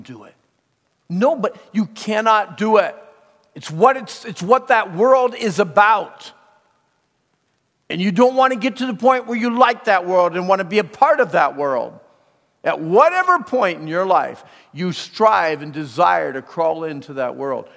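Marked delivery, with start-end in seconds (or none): 1.1-12.0 preaching at full force. Acted. Preacher thundering at young people about the world. 12.6-17.7 preaching at full force. Acted. Preacher thundering at young people about the world.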